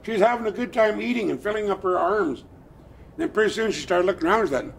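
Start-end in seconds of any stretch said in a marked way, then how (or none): none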